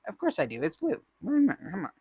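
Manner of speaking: usual speaking voice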